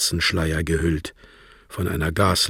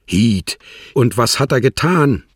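higher and louder, urgent; agitated demand; 5 semitones higher, 6 dB louder than usual. A narrator's reading. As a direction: snap the question out in alarm. Ossipon urgently demanding details. (aufgeregt, höher und lauter nachhakend)

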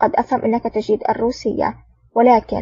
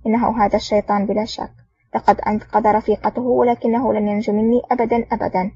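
ordinary delivery, nothing marked

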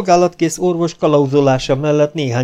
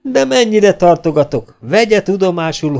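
louder, higher threat; threatening sergeant; 8 semitones higher, 4 dB louder than usual. A narrator's reading. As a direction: warn him off, pitched up. (az őrmester fenyegetőzik, emelt hangon)

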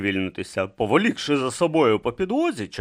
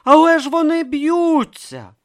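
high, loud, drawn-out protest; incredulous protest; startled objection; 8 dB louder than usual. Acted. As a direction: object in astonishment, pitch up high. Alarmed objection to the brawl.

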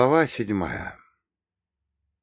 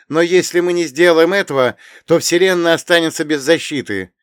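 urgent heroine; 8 semitones higher, 9 dB louder than usual. Acted. Irina insists.

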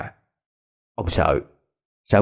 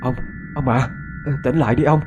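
higher, fast, rising alarm; frightened wife calling her fallen husband, higher and fast; panicked call; 5 semitones higher, faster than usual. Acted. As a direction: wake him in a panic. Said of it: "hốt hoảng chạy lại đỡ ông dậy rồi la lên".

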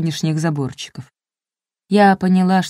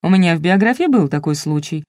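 ordinary delivery, nothing marked

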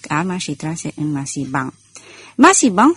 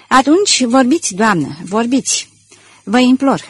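urgent, raised pleading voice; 5 semitones higher, 4 dB louder than usual. Acted. Detective urgently begging the lady to reveal the secret. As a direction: press her hard to speak.

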